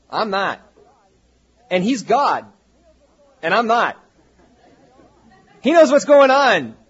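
emphatic preacher building to a near shout; forceful preaching. Real, not acted. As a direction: drive each point home loudly, with pauses. Preacher drives the point home.

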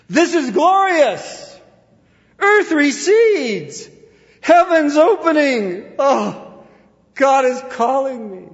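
very high, loud, drawn-out ecstatic cry; acted rapture. Preacher voices dying Moody seeing heaven, rapturous cry. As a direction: call out as if watching heaven open.

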